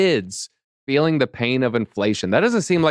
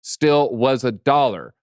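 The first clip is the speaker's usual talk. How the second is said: slowed punchline; slower than usual. Acted. Stretched punchline of the repetition.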